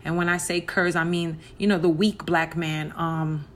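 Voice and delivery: usual speaking voice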